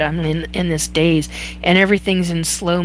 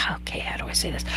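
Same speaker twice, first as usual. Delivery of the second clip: much softer, whispered to herself; whispered aside; 3 semitones lower, 14 dB quieter, much faster than usual. Real, not acted. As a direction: mutter it under your breath while searching. Aside while searching for words.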